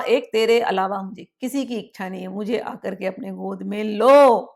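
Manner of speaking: usual speaking voice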